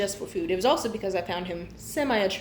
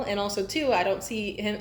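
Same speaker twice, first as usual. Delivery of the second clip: normal talking voice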